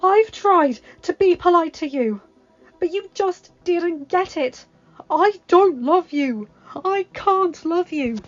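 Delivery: high, loud and emphatic, slowing on key lines; impassioned rejection. Acted. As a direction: tell him off, then declare love. Belle rejects Gaston.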